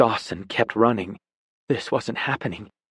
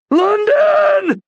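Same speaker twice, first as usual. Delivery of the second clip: very high, loud yell of her name; desperate yell; 8 dB louder than usual. Acted. Dawson yells for London, desperate and loud. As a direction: yell it with everything. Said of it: "He yelled her name with everything in him".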